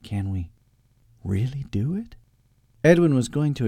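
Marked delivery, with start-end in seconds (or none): 0.0-2.2 tentative, hushed question rising at the end; fearful hope. A narrator's reading. Eustace, afraid again, asks Edwin, tentatively. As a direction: ask it timidly, voice lifting. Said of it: "the fear came over him again".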